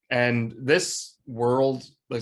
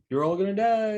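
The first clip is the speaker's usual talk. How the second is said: mock doom warning; 9 semitones higher than usual. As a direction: call it out high in pretend alarm. Mimics the scene's doom warning.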